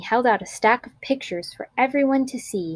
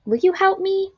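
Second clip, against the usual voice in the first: lifted, hopeful question much higher; teacher's higher hopeful ask; eager teacher asking; 10 semitones higher than usual. A narrator's reading. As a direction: ask the class with a twinkle. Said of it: "Missus McBee's eyes twinkled".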